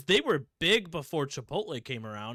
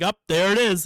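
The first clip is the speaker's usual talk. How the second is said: much louder and higher teasing gotcha; mock gotcha; 6 semitones higher, 14 dB louder than usual. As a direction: catch him out gleefully. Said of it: "And she's like".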